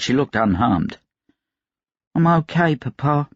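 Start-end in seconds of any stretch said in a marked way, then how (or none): 2.1-3.3 put-on higher, small girl's reply; a brave little girl reassuring her papa. A narrator's reading. Astrid to her father, a lightly voiced brave little girl.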